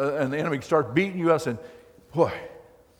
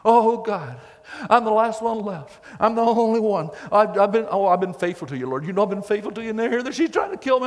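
mock whining; 6 semitones higher than usual. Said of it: "started bawling and squalling".

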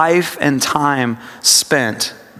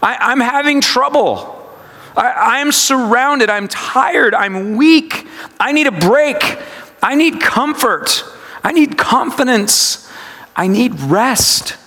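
higher-pitched, mildly strained plea; a touch of pleading, pitched up; admitting distress; 7 semitones higher than usual. Acted. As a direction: confess each need in separate phrases. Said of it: "admitting that I am distressed".

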